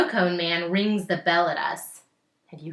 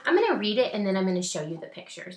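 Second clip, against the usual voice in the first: plain reading voice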